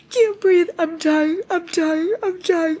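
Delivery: high, breathless squeal of excitement; real giddy overload, high and loud; hyperventilating. Real, not acted. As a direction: gasp it out, overwhelmed with delight. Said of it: "I'm hyperventilating at this fucking part".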